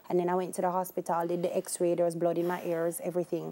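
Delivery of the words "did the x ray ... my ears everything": ordinary talk, nothing marked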